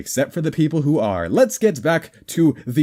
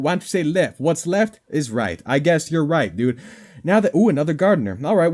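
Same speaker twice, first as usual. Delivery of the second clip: ordinary talk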